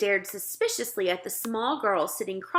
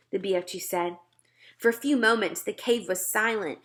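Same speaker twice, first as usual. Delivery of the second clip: plain reading voice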